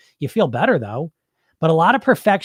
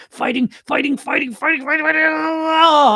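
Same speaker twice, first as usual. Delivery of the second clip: mounting strain; 12 semitones higher, 4 dB louder than usual. Acted. Mimicking a struggle against hunger.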